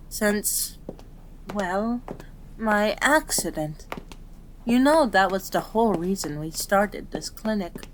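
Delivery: usual speaking voice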